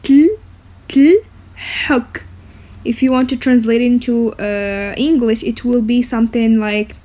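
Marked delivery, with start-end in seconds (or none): 0.0-2.2 "tl" clipped then "huk" whispered out; syllable drill. Shown as a demonstration. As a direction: break the word into tl, tl, huk. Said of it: "we say matisha".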